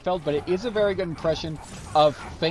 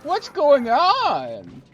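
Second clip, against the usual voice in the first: slow, higher, louder bad Seinfeld observational whine; Jerry Seinfeld impression; 8 semitones higher, 4 dB louder, slower than usual. Mocking famous people doing Seinfeld, a bad Seinfeld voice. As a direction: hammer each question like a bad Seinfeld bit. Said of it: "That's not a very good impression of Jerry Seinfeld".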